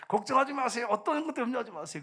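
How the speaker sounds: high-pitched, insistent pulpit urging; preaching exhortation. Retold or imitated. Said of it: "설교를 막 이렇게 해놓고".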